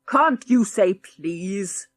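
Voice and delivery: put-on slow, loud old man roar. Old grandad scolding the rude boy, roaring. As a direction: bark the rebuke from the corner. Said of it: "roared a voice from the corner".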